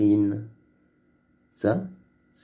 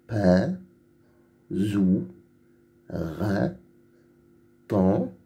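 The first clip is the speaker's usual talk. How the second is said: each syllable from "Rang" to "doigt" alone, followed by a pause; lecture de syllabes; much slower than usual. Shown as a demonstration. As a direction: read "Rang", "toi", "goût" as isolated syllables. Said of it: "Lis les syllabes". (syllabes lues une à une, bien séparées, de « Rang » à « doigt »)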